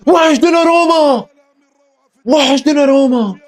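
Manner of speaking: sing-song mock lament; a fan teasing the rival team, drawn out. Real, not acted. Repeats the phrase mockingly.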